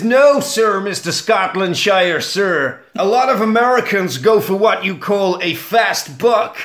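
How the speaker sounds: Spencer. Acted. Spencer corrects Jack, exaggerated American accent. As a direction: proclaim it as an over-earnest American. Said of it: "Spencer steps in and he says".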